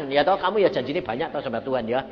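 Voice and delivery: usual speaking voice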